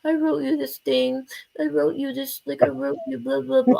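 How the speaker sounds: hammed-up pitiful little voice; mock sad. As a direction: mockingly pout out a sappy goodbye. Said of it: "all sad, like".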